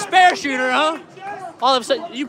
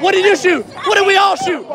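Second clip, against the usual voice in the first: raised, higher demand; loud challenge; 6 semitones higher, 5 dB louder than usual. Real, not acted. Challenging teammates about their scores, loud high shout. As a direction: call them out on their scores.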